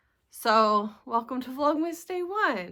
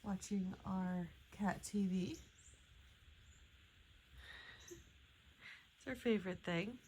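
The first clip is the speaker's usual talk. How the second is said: hushed; 2 semitones lower, 14 dB quieter than usual. Real, not acted. Quiet morning filming beside watching cat.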